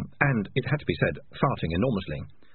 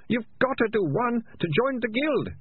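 high, whining excuse; pitiful high-pitched whine; moaning. A narrator's reading. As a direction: plead your case in a high moan. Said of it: "Ratcatcher Two moaned".

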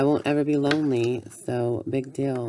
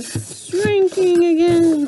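much higher and louder, slowed; squeaky shrinking voice; 14 semitones higher, 9 dB louder, slower than usual. Pretend. Character shrinking down cries out in a high squeal.

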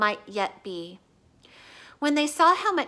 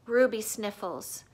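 plain reading voice

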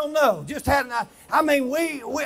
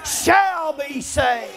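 preaching; 6 semitones higher, 5 dB louder than usual. Acted. Preaching at full force.

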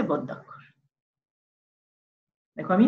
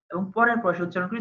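ordinary talk, nothing marked

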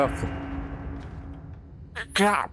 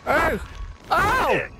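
startled pained yells; much slower than usual. Real, not acted. Attacked, yelling.

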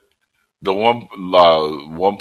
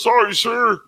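high mock meek reply. Quoting gunner.

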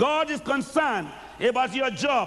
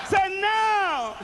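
higher shout; 7 semitones higher than usual. Real, not acted. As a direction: lift "now" higher, shout it out. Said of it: "say now, everybody".

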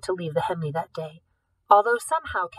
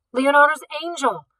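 stunned cry; 7 semitones higher, 6 dB louder than usual. Acted. Man reports theft in shock.

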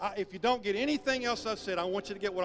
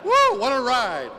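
excited whoop; 11 dB louder, slower than usual. Real, not acted. Preacher reacts after the story.

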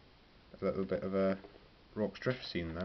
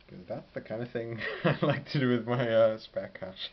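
laughing; 4 semitones higher, 6 dB louder than usual. Real, not acted. Laughing at own hobby spending.

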